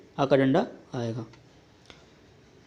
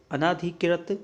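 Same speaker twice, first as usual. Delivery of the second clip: normal talking voice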